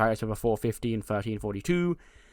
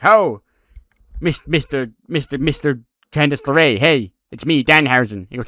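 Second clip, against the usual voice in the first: a lightly voiced quirky character; Danhausen voice; 2 semitones higher, 9 dB louder than usual. Danhausen mocking Johnny's name, lightly voiced. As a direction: stammer playfully as Danhausen.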